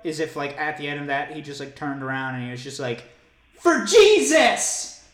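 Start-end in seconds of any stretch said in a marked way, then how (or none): 3.6-5.0 high, loud, drawn-out dedication; big hammed-up holler; triumphant shout. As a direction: cry it out like a showman to a crowd. Acting out the worship outburst.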